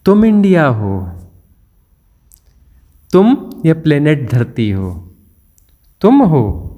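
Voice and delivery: ordinary delivery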